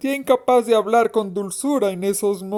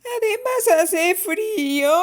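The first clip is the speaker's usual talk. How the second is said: put-on very high, slow small-child whine; drawn-out, high little-girl complaint; little girl near tears; 12 semitones higher than usual. A narrator's reading. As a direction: whimper it like a tiny girl about to cry. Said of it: "exclamó la pequeña Alejandra a punto de llorar".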